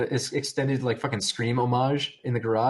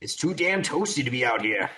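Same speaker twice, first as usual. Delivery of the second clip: put-on cranky old man. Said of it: "I'm a cranky old man".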